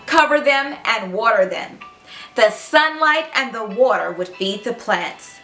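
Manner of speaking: plain reading voice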